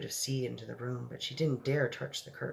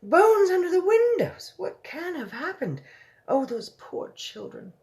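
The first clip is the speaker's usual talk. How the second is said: high, loud horrified royal voice; a queen aghast at the news; 10 dB louder than usual. Acted.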